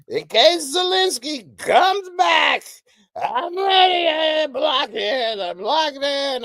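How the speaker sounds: high, slow, loud Trump voice; hammed-up Trump blocking; Trump impression. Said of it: "He's practicing his karate moves".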